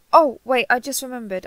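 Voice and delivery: loud, urgent burst of remembering; sudden realisation. Acted. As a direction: blurt it out as it hits you. Sudden alarmed realisation mid-conversation.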